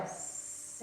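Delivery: long breathy sss on the exhale; snake hiss. Shown as a demonstration. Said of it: "exhale like a snake".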